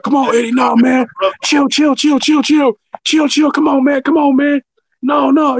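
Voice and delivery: pleading coworkers. Retold or imitated. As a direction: beg him to calm down. Coworker restraining him.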